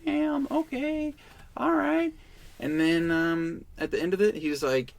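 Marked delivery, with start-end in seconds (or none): 0.0-2.1 taken aback. Retold or imitated. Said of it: "And I'm like".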